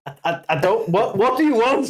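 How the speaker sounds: stammering, rising to a high bewildered cry; confused Rock. Pretend. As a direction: plead with a booing crowd, baffled. Rock confused by booing crowd.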